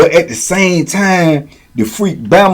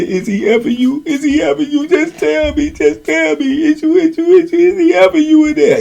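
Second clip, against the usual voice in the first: high, insistent mock whine; imitated anxious pleading; 11 semitones higher than usual. Mimicking the jealous man questioning Boo Boo, high pleading. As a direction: press for an answer in a climbing voice.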